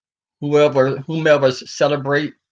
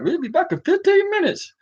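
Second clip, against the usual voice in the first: much higher mock broadcaster voice; mock announcer; 13 semitones higher than usual. Mocking the rocket livestream host, much higher. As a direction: squeak out the cheery sign-off in a mocking voice. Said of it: "But they're like".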